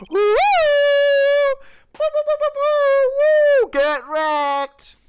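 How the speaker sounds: high, loud string of whoops, then a taunt; loud, high-pitched victory hooting; gleeful whooping. Real, not acted. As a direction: whoop wildly and gloat. Cheering and taunting in game.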